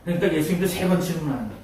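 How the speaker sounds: normal talking voice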